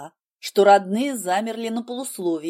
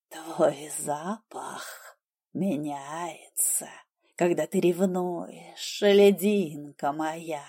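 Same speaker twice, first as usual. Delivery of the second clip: whispered low and slow in the ear; insistent murmur; 4 semitones lower, 5 dB quieter, much slower than usual. Acted. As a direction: breathe it slowly into her ear. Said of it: "настойчиво пробормотал Хелвард".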